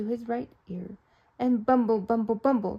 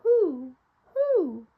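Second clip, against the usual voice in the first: owl hoot; 6 semitones higher, 5 dB louder than usual. A narrator's reading. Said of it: "the owl asking".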